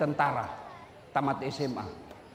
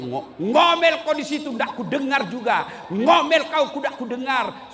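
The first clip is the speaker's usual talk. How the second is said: mocking taunt; 7 semitones higher, 5 dB louder than usual. Husband mocking wife in well.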